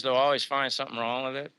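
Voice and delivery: ordinary talk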